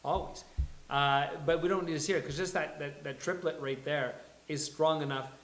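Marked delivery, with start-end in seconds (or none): none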